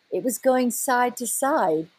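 normal talking voice

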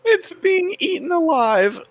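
high squealing horror; mock horror.

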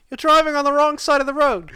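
high, loud mock British accent; British cop voice. British cop pulling over a driver, high hammed-up British accent. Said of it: "Do the voice".

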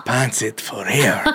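put-on botched African accent. Parodying a Wakanda cheer, low muddled African accent.

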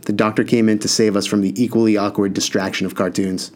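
usual speaking voice

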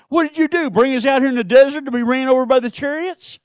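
high, loud mock griping at Moses; griping complaint. Israelites griping at Moses in the desert, mock angry whine. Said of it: "They started griping".

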